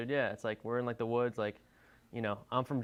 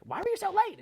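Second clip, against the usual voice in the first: put-on very high, louder nagging girlfriend voice; shrill-high mock girlfriend demand; annoyed girlfriend; 5 dB louder than usual. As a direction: pitch way up like the impatient girlfriend.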